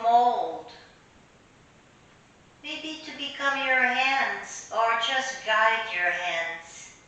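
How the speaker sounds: unhurried meditation voice with long pauses; guided meditation. Real, not acted. Teacher leading listeners through an imaginary clay meditation, guided meditation.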